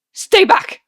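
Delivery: sudden loud, high snarl; a cornered man snaps back hard; snarled warning. Acted. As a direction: shove the words at him in panic. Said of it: "Arthur snarls".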